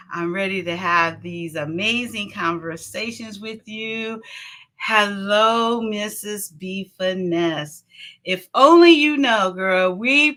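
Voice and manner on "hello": ordinary talk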